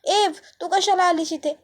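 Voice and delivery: understated, high-pitched indignant shout; mild angry yell. A narrator's reading. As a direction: raise the pitch as if yelling at someone unwelcome. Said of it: "पूजा जोरात ओरडते".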